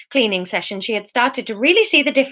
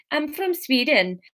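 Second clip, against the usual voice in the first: slow, soft try at a Swedish accent; hesitant Swedish accent demo; mock Swedish; 5 semitones higher, 4 dB quieter than usual. Shown as a demonstration. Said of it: "Is that a Swedish accent".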